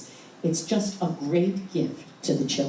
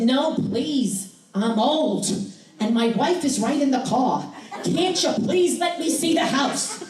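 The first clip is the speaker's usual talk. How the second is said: put-on higher, whiny begging old man; pleading old man; 7 semitones higher than usual. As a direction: beg like a pitiful old stranger. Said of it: "And he said".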